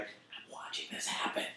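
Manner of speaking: awed whisper to yourself; whispered thought. Retold or imitated. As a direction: breathe it out in disbelief. Narrator's own amazed thought.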